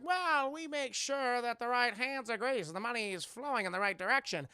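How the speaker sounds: hammed-up old-time gangster; put-on gangster impression; mobster boss voice. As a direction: explain it like a movie mobster.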